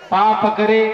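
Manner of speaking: "पा पकड़े" as two separate words, first one held; words split apart. Shown as a demonstration. Preacher revealing the couplet's double meaning, "पा पकड़े" pointedly split. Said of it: "अलग करिए".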